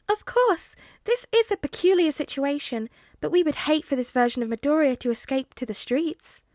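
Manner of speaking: high, bright animal voice; a small creature's polite squeak; high squeaky voice. Acted. Nezu speaks, high voice.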